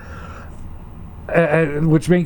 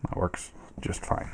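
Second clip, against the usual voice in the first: whispered aside; 6 semitones lower, 15 dB quieter than usual. Real, not acted. Quiet aside to self.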